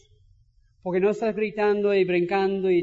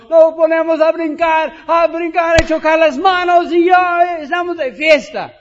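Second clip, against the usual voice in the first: much louder and higher, partying celebration; jumping fan; 7 semitones higher, 12 dB louder than usual. Pretend. Preacher showing how he celebrates goals at home, loud and jubilant. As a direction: bounce with joy like a goal was scored. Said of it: "otras gentes como yo".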